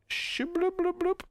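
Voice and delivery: high, slow bubbly bloop noise; a rising bubbly sound effect. Retold or imitated. Imitating the seed effect.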